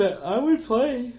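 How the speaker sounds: words pulled long by a yawn. Real, not acted. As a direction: yawn through the phrase. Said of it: "Sorry, I'm yawning".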